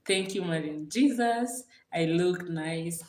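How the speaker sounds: ordinary talk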